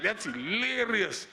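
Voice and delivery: slow, sarcastisch gerekt "That's hilarious"; sarcastic. Retold or imitated. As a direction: drag it out with dry sarcasm. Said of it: "I was like".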